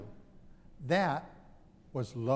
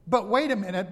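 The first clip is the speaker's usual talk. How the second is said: mock protest; 4 semitones higher, 8 dB louder than usual. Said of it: "you can hear him wanting to say".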